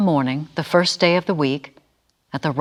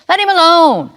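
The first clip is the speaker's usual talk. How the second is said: bystander; 7 semitones higher, 5 dB louder than usual. A narrator's reading. Bystander stops the others.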